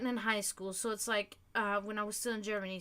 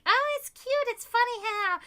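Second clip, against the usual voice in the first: much higher, light mock cooing; imitating amused friends; 12 semitones higher, 6 dB louder than usual. Friends reassuring her slip into German is cute, cooing high. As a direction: coo it like the friends found it adorable. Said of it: "And they're like".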